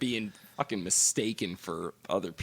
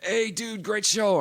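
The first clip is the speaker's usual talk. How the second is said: eager fan; 12 semitones higher, 5 dB louder, slower than usual. Retold or imitated. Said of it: "first thing he goes".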